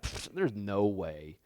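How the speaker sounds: low, scoffing inner voice; mock skeptical churchgoer; dismissive disbelief. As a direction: scoff under your breath, dragging out "no way". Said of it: "in your mind you're like".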